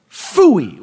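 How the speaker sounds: mock-annoyed small child losing at cards; a little attitude. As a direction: punch out a huffy "phooey". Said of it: "with a little bit of attitude".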